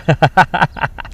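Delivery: real, quick cackling laughter; amused laugh. Real, not acted. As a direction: crack up at the joke. Laughs at tractor joke.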